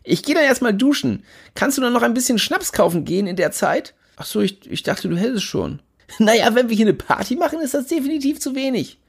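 cocky friend, pushy and carefree; Jimmy Blue voice. Acted. Buddy Jimmy Blue, voiced by narrator.

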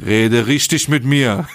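slow, drawn-out retold demand; slow confrontational demand. Driver confronting an Italian border officer, slow mock demand. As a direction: imitate him, stretching each word out.

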